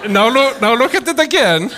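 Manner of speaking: imitating an excited, amused friend; amused caller. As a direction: egg a friend on to look, tickled by it. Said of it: "this is hysterical".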